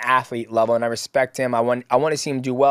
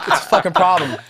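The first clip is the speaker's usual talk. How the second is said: laughing taunt; 5 semitones higher, 4 dB louder than usual. Retold or imitated. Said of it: "I was like".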